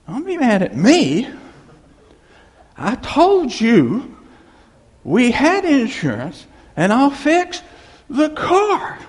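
high, loud, drawn-out protest; exasperated husband. Acted. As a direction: act out an indignant husband, slowly and emphatically. Husband arguing back at angry wife.

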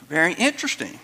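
normal talking voice

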